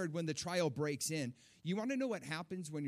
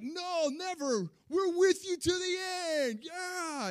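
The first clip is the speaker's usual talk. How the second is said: mock rallying cry, very high and loud; revved-up disciples; 7 dB louder, slower than usual. Disciples pledging loyalty to Jesus, imitated revved-up shouting. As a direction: stretch it into a hyped-up group cheer. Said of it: "they're all, you know, revving themselves up".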